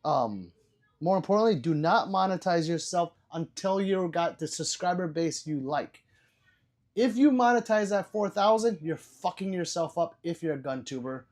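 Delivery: ordinary talk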